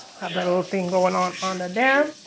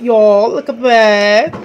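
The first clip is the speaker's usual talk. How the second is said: excited showing off; 7 dB louder, slower than usual. Real, not acted. Showing off the curry goat.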